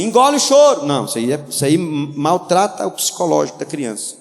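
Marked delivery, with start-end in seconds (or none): 0.0-0.9 higher, louder harsh command; harsh scolding. Shown as a demonstration. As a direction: bark it like a scolding parent. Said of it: "Não pode é falar assim".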